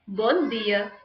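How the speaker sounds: "bom dia" with a plain hard d in dia; European accent. Shown as a demonstration. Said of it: "So in European accent".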